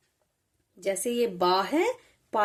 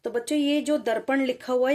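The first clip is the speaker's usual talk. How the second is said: ordinary delivery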